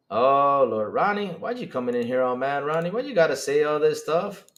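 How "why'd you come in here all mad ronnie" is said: ordinary delivery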